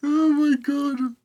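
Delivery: trying not to laugh; choking back laughter; stifled giggles. Real, not acted. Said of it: "I'm trying not to laugh".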